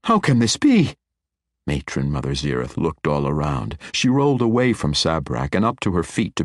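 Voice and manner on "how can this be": high, louder cry of disbelief; the ground falls out from under her; shocked. A narrator's reading. Matron reacting to the lost magic, shocked.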